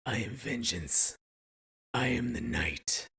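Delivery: slow gravelly vigilante growl; Batman growl. Pretend. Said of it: "give me your crime fighter vigilante voice".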